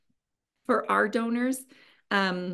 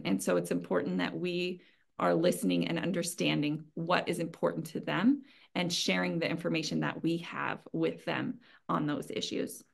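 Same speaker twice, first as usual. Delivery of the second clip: ordinary talk, nothing marked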